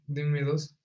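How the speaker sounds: usual speaking voice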